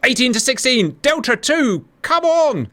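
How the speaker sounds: high, rousing commentary; excited score call. Real, not acted. Commentator as the kill gap narrows to two, excited. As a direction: call the tightening score with rising pitch. Score tightens in live battle.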